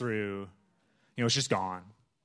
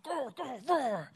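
mock roar; 12 semitones higher, slower than usual. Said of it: "And I'm like".

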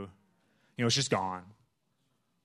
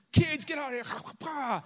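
grumpy tired dad; 14 semitones higher, 4 dB louder, slower than usual. Retold or imitated. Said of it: "And I'm like".

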